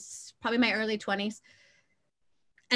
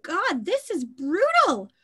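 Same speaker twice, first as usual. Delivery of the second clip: amazed; 6 semitones higher, slower than usual. Retold or imitated. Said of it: "I remember watching it and going".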